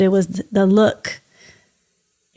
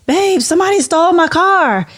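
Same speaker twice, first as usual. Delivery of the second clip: higher, louder panicked cry of "Babe, somebody stole my car"; frantic retold alarm; 10 semitones higher, 4 dB louder than usual. Wife bursting back in to tell husband, frantic and high. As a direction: relive the shock of the empty driveway.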